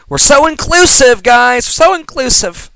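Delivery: high, loud mock-enthusiastic quote; mocking cheer. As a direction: gush it with sarcastic pep. Mocks an inclusive classroom post.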